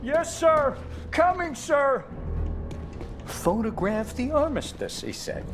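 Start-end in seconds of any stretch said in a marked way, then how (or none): 3.2-5.0 high, slow mimicry of the boss; mocking quote. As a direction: parrot his promise sourly. Underling mimics officer's promise.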